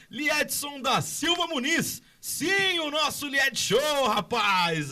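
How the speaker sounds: hyped radio host hailing a star; excited shout. Real, not acted. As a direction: belt out the name with pride. Host hails the honored idol. (grito empolgado)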